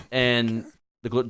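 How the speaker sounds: ordinary talk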